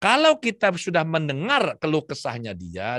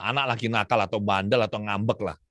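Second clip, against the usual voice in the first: normal talking voice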